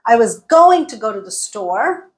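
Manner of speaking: GOING and STORE stressed, everything else reduced; two stressed words. Shown as a demonstration. Teacher taking one bit at a time, two words stressed. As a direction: punch GOING and STORE, rush "to go to the". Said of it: "Going and store got stressed".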